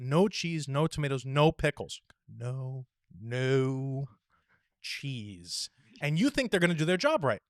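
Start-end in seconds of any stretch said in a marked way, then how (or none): 2.3-5.7 slow word-by-word correction. Acted. Modelling a customer correcting the cashier, slow and over-clear. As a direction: separate every word as if for a slow listener.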